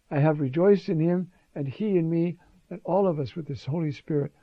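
normal talking voice

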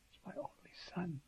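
hushed, breathy secret; whisper. Acted. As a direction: whisper it tenderly, as a confidence. Intimate aside in Father's voice.

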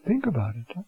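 ordinary talk

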